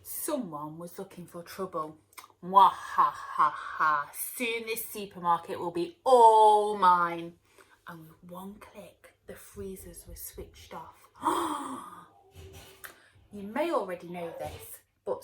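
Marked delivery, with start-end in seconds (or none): 2.4-4.0 loud, slow villain cackle; booming evil mwahaha; evil laugh. Acted. 4.4-7.3 loud cartoon villain voice; booming baddie boast; gloating villain. 11.2-12.0 loud high shocked gasp; sharp high gasp. Acted. Veg react to freezers.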